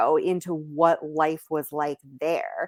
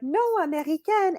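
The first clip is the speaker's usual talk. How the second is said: high, chirpy "No, American", much higher; bright correction; 12 semitones higher than usual. Retold or imitated. An American correcting a French local, high and chirpy. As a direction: correct them brightly, a little proud. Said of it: "I'll be like".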